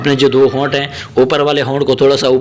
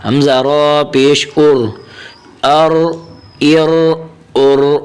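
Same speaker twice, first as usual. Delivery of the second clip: slow, measured Arabic letter drill; teacher reciting a Qaida drill slowly; Qaida drill recitation; much slower than usual. Shown as a demonstration. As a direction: chant the letters like a Quran teacher.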